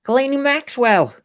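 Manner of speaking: high mock American name-drop; Jeffrey Epstein impression. Repeating her name in character, insistent mock American. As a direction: spell it out in the put-on voice. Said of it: "That's my accent for G".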